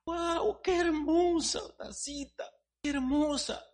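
high, slow gushing woman's voice; squealing with delight. Acted. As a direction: gush over a pretty teacup. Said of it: "ya saben las mujeres cómo son cuando miran algo que les gusta".

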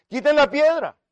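high, loud order; ringing shout to move the stone; shouted command. Acted. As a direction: call it out with sudden authority. Jesus quoted again, commanding.

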